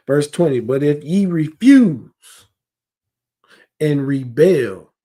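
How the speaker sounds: slow, solemn reading of the verse; scripture reading. Real, not acted. Preacher to his listeners, slow solemn scripture reading. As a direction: read the verse slowly to the congregation.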